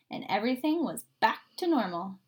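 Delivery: usual speaking voice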